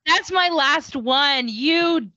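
high, drawn-out protest; playful dismay. Real, not acted. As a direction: wail it slowly in mock outrage. Her last pick has been taken.

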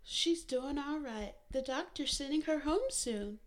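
lightly voiced nurse giving good news. A narrator's reading.

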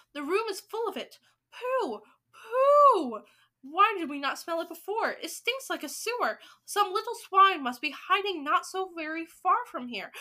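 high, loud outraged clamour; shouted disgust. Acted. Witches complaining about the stench, shouting high. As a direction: cry out at the stench, pitch way up. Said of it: "they shouted".

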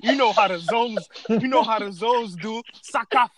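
high, loud boastful hype voice; Haitian partygoers bragging. Retold or imitated. Mocking Haitian fans bragging they took over carnival, high hype voice. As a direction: brag like a carnival crowd claiming the win. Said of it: "everybody from different countries like".

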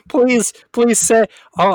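nasal, slow and loud. Real, not acted. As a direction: say it through the nose. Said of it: "this nasally voice shit that's coming off from me".

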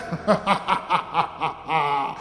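a low villainous laugh, a touch hammed-up; evil laugh. [name] laughing at the priest, mock evil.

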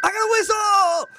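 high, slow, excited yell; excited shout. Retold or imitated. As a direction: cheer about getting a whistle. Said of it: "everybody, like, is like".

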